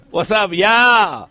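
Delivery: a hammed-up shouted greeting; mock shout. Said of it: "If I go down to Mexico".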